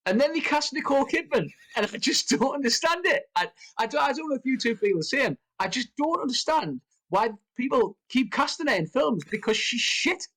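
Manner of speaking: high-pitched, incredulous exasperation; exasperated rant. Real, not acted. Podcaster venting to co-hosts about a casting choice, exasperated. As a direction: rant in real disbelief, voice pitched right up. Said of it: "I sound like I'm exaggerating".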